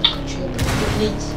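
normal talking voice

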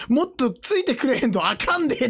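hammed-up Kansai impression; thick Kansai dialect. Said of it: "コテコテの関西弁だから".

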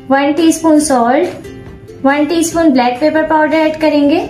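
normal talking voice

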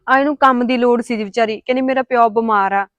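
loud retelling to the man of the house; loud explaining. Acted. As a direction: speak up to report it.

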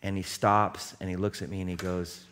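normal talking voice

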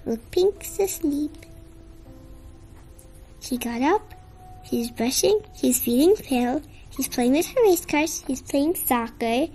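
usual speaking voice

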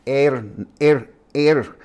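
er said as in English Ernest; wrong er sound. Shown as a demonstration. Teacher mimicking the wrong English er. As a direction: drag out er. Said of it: "it's not Ernest".

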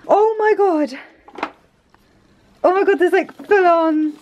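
high, amazed surprise; surprised. Real, not acted. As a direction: react to the hidden gifts. Finds presents in drawer.

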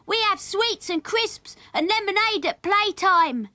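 put-on high, loud child's boast; boastful shouting. A narrator's reading. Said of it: "he shouted after them".

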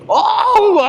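high, sudden aa; startled gasp. Real, not acted. Courier nearly driving into the wrong street, startled high aa. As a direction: caught off guard.

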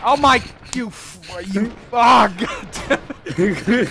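loud, frustrated shouting at the game; furious outburst. Real, not acted. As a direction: yell it out after getting killed. Killed in game, swearing.

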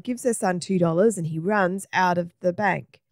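plain reading voice

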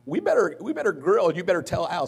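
hammed-up wife nagging to grill; urgent mock warning. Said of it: "Tracy's like".

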